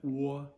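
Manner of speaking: O as a high, held "waw". Shown as a demonstration. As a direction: round O into a loud New Jersey "waw". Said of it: "a W quality to it".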